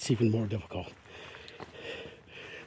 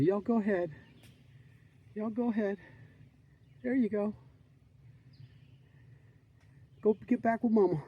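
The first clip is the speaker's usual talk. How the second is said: gentle pet talk; 7 semitones higher, 8 dB quieter than usual. Real, not acted. Shooing fawns back to mother.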